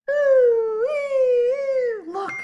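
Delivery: very high, loud excited squeal; high thrilled oohs. Acted. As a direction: squeal with delight at the fire engine. Monkey sees fire engine.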